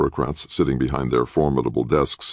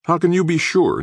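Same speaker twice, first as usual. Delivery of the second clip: higher, pointed challenge; demanding; 4 dB louder than usual. A narrator's reading. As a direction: press him hard for an answer. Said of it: "Nick demanded".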